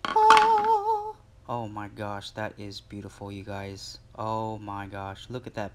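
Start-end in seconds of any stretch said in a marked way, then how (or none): 0.0-1.2 a very high, very loud sung "Oh!" of delight; excited squeal. Real, not acted. Vlogger unboxing his anniversary knife, a real high delighted squeal. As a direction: squeal it like you just saw your dream knife. First sight of dream knife.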